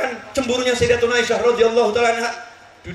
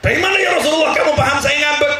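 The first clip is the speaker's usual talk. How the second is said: eager question; 6 semitones higher, 5 dB louder than usual. Retold or imitated. Aisyah asking the Prophet.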